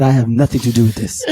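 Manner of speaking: mock African-accented protest. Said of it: "He put the accent on".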